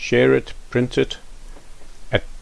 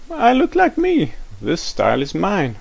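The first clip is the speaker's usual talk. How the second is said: put-on proud boy; 9 semitones higher than usual. Rafiki answers proudly.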